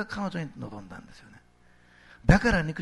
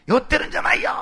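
high, emphatic mock shout; raised voice; 11 semitones higher, 6 dB louder than usual. Peter denies being drunk, raised voice. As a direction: call out a protest to a crowd. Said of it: "大声で、".

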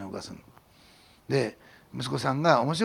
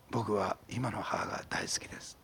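slow, tender whisper of the son; quoting the son in a slow whisper; whispered quote; 6 semitones lower, 8 dB quieter, slower than usual. Retold or imitated. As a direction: hush it like a sacred vow. Son's baptism testimony quoted.

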